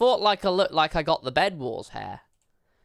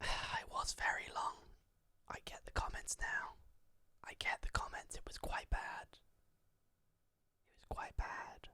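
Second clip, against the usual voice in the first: breathy hushed whisper, much softer; 19 dB quieter, slower than usual. Acted. Streamer confides to chat about old clip, soft whisper. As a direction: whisper it like a guilty confession.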